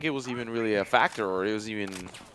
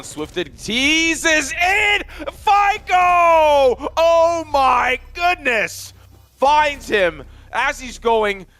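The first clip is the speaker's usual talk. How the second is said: screaming esports caster; 12 semitones higher, 5 dB louder, slower than usual. Real, not acted. Caster calls the clutch live.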